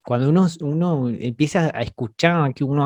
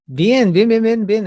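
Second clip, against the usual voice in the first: higher, louder, pleased approval; excited praise; 8 semitones higher, 5 dB louder, slower than usual. Real, not acted. Teacher praising the class's correct answer, excited. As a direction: cheer the right answer.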